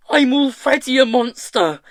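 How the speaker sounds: high, very loud anguished shriek; furious, high-pitched outburst. A narrator's reading. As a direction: shriek it back at him. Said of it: "she shrieked".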